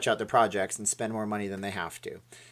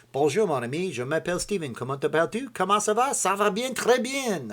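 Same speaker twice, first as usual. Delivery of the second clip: mock French; 8 semitones higher, 3 dB louder than usual. Mock French phrases to chat.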